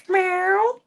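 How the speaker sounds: high drawn-out meow through a laugh. Pretend. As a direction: meow with a giggle.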